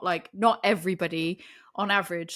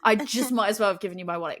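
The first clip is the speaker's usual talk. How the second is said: ordinary talk, nothing marked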